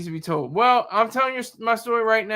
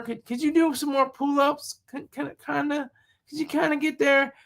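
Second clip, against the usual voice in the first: high, timid, pleading drill sergeant; soft-spoken drill instructor; 5 semitones higher than usual. Retold or imitated. Drill instructor pleading gently with recruits, mock timid. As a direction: ask the recruits sweetly like a pushover. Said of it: "you don't expect your drill instructor to be like".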